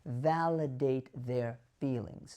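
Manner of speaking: ordinary talk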